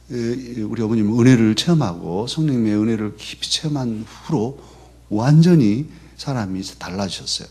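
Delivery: normal talking voice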